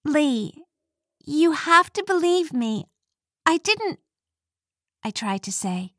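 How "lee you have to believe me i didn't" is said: higher, desperate plea; a touch of desperate pleading. A narrator's reading. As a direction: beg him to believe her. Pleading with Lee.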